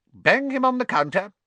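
a brisk order from a witch; witch shopkeeper. Acted. Shop witch tells Ron what to do, high and loud.

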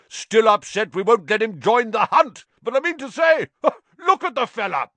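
loud, high, jovial jeering shout. A narrator's reading. Sir Patrick's head mocking Nick from the floor, shouting. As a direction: bellow it mockingly, with a laugh. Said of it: "shouted Sir Patrick's head".

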